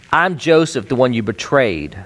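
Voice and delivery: I'm Joseph, said as a pointed rebuke; accusing. Retold or imitated. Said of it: "He could have easily said".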